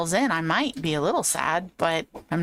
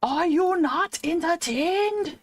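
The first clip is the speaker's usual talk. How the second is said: higher, slow gladiator roar; hammed-up arena champion; Russell Crowe impression; 8 semitones higher, slower than usual. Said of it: "He doesn't sound like that".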